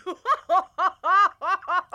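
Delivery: a high creature laugh bursting out; high giggle. A narrator's reading. The creature laughs at Charles's surprise. Character giggles, far timbre.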